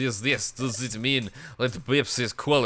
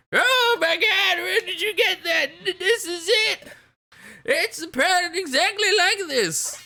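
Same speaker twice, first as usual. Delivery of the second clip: excited gasp. Acted. Character finds matching pattern.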